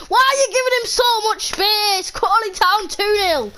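exasperated gamer commentary; exasperated outburst. Real, not acted. Exasperated commentary on conceded goal.

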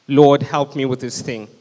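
ordinary talk

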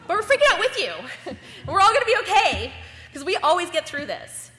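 cheerful laugh, we're in this together; laughing reassurance. Retold or imitated.

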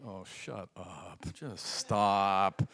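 slow, low, weary dismissal; mock-exasperated groan of words; exasperated retort. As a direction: drag it out, fed up. Mock retort to bad teaching.

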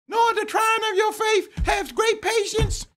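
very high, far-off squeaky shout; high squeaky Peter. Acted. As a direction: squeak it out as if his voice broke. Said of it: "He said".

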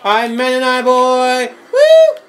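high, loud, drawn-out cheer; hyped shout. Pretend. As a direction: whoop it out slowly, ending on a high woo. Calling out game character choice.

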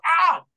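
loud high "ah" yelp; cry of falling. Acted. Bar owner kicked off his feet, loud high yelp. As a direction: yelp sharply as you topple backwards.

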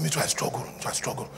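a hint of weary confiding; quiet confession. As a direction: sigh it out softly. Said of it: "I said".

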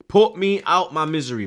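high, loud begging; loud pleading. Real, not acted. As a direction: beg at full volume, fed up. Fan begging ref to end.

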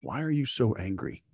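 lightly voiced calm old trainer; calm grandfatherly. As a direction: ask it quietly like a patient grandfather. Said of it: "And Jeff Bridges is calm".

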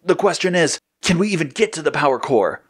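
contained storyteller's shout; shouted question. A narrator's reading. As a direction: call it out over a fight. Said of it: "Blue shouted to the others".

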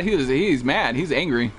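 usual speaking voice

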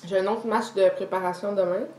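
cup at the lips; speaking between sips. Real, not acted. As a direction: drink in the mouth. Seen 0: green cup held at or on lips throughout the words.

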